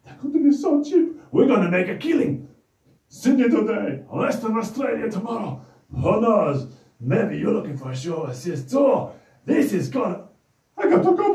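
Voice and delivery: hyped-up, high puppet voice, loud bursts; bouncing with big news for the kids; excited puppet Anton. Acted. Excited puppet voice hyping the kids up.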